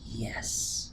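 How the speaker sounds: a soft, breathy little yes under the breath; whispered yes. Pretend. Interviewer voicing an actor's inner yes, whispered. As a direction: whisper it like a private thrill. Imagined eager yes before rehearsal.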